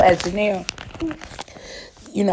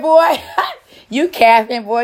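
laughing; 7 semitones higher, 3 dB louder, slower than usual. Retold or imitated. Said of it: "She said".

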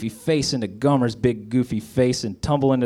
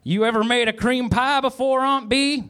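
high-pitched, drawn-up call to Aunt Bee; mock yell; 11 semitones higher than usual. A narrator's reading. Andy calling to Aunt Bee as he approaches, mock yell. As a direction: call it out high without real volume. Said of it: "Andy yelled out".

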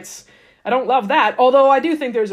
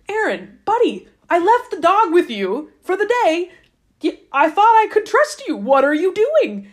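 a hint of a scolding owner, pitched higher and louder; exasperated Moses; 8 semitones higher, 4 dB louder than usual. Moses scolding Aaron like a careless pet-sitter, mock exasperated. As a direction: chew out the careless dog-sitter, half-joking.

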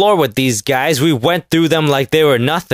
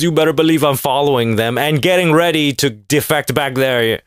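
ordinary talk, nothing marked

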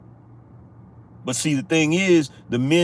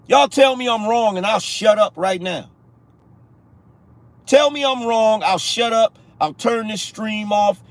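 pitched up and pushing, near a shout; raised challenge; 6 semitones higher than usual. Real, not acted. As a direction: dare them to answer, voice raised high. Daring viewers to prove him wrong.

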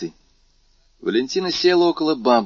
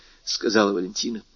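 ordinary delivery, nothing marked

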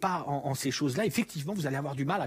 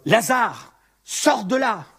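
loud, high call to the dead man; loud cry; 7 semitones higher, 10 dB louder, much slower than usual. Acted. Jesus calling Lazarus out of the tomb, loud cry. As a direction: cry it out so the whole crowd hears. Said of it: "il cria d'une voix forte".

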